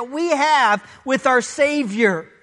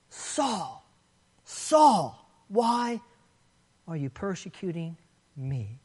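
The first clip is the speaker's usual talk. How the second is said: Saul, Saul said much softer, hushed and drawn out; a grieved, hushed rebuke rather than a shout; soft emphatic rebuke; 8 semitones lower, 9 dB quieter, slower than usual. Acted. Jesus rebuking Saul on the ground, in a soft hushed voice. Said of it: "The repetition is emphatic".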